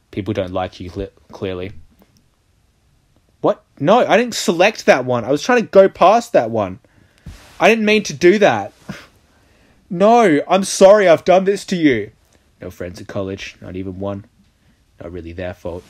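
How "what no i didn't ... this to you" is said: panicked apology. Real, not acted. Player panics at wrong choice.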